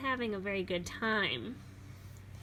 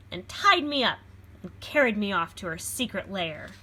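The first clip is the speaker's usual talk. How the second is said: usual speaking voice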